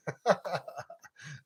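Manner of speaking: teasing chuckle after a joke; amused laugh. Real, not acted. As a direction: laugh at your own playful hollering.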